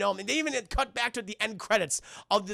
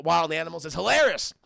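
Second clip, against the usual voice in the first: ordinary delivery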